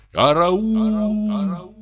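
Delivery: high, drawn-out wail carried on wind; distant cry for help. A narrator's reading. Cry reaching the hut through the storm, high faint drawn-out call. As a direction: call out long and high, without volume. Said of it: "донёс явственный крик".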